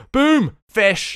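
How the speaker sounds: loud, high victory call; triumphant. Real, not acted. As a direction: shout it as the catch lands. Celebrates catching a fish.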